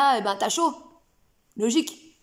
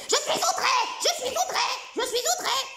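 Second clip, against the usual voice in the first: outraged bestiole; 10 semitones higher than usual. Acted. Insulted bestiole protests to the coach, high outraged squeal. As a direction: shriek your offence like a prissy little creature. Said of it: "quand elle disait".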